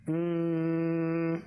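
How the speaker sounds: normal talking voice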